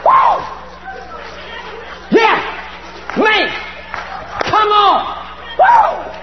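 high, shouted cheers; whooping celebration. Acted. Disciples high-five over subject demons, jubilant whooping. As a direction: whoop it up like a winning team.

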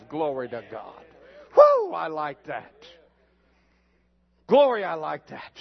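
delighted praise. Real, not acted. Preacher delighted after revelation.

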